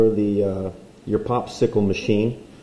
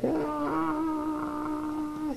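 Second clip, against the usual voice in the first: high, soft falling cry; a tiny cartoon yelp as he drops; falling scream; 13 dB quieter than usual. Cartoon man falling off a cliff, high yelp.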